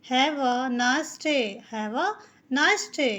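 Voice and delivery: "Have a nice day" drawn out very slowly, each word separate; very slow model sentence for beginners; slow teaching read. Shown as a demonstration. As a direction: dictate it slowly for learners to copy. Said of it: "तो have पहले आ जाता है".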